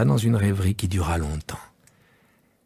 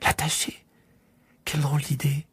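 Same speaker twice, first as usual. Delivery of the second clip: put-on hushed astonished child; child shocked at tying up the sheep; shocked little prince; 6 semitones higher, 4 dB quieter than usual. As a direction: recoil at the idea. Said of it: "parut choquer le petit prince".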